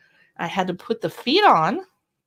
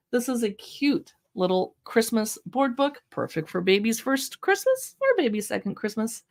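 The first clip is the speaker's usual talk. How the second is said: ordinary talk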